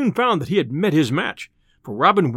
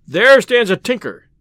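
loud; 5 semitones higher, 7 dB louder than usual. Acted. Robin answers urgently.

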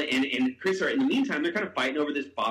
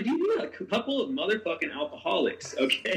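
retold exasperated scolding; 6 semitones higher than usual. Retold scolding of fighting friends.

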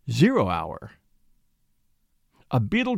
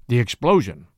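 ordinary delivery

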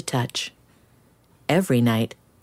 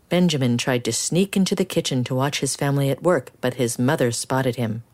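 ordinary delivery, nothing marked

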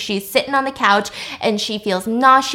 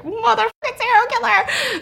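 mock thrilled exclamation; 11 semitones higher than usual. True crime fan spotting a killer, high mock excitement. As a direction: let it out in a high, hammed-up voice.

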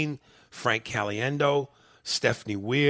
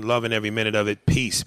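ordinary talk, nothing marked

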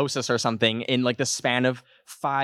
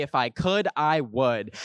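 usual speaking voice

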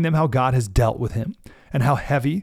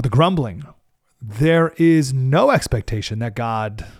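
ordinary delivery

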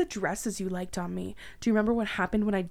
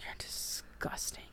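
slow whispered revulsion; hushed disgust; 12 dB quieter, slower than usual. Acted. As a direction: spit it under breath.

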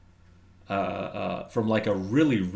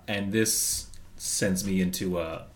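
usual speaking voice

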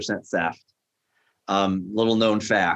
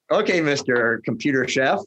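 mock condescending, a touch higher; sarcastic chef; 5 semitones higher than usual. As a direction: say it like a put-down. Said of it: "not the way I call him chef".

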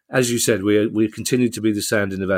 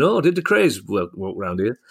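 Orville the Duck. Retold or imitated. Retelling their first meeting in Soho, mock Orville the Duck voice. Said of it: "he would talk like Orville the Duck".

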